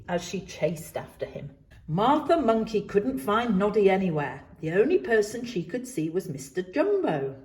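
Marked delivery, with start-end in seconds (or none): none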